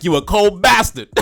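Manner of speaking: high, slow, loud praise; admiring exclamation. Retold or imitated. Friend praising [name]'s verse, high slow exclamation. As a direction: drag it out impressed. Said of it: "He was like".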